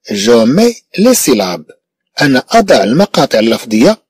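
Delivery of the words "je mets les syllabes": slow, measured teacher reading; slow dictation. Shown as a demonstration. Teacher reading the instruction slowly to pupils, measured. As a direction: read each word slowly for the class. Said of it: "teacher models the instruction slowly".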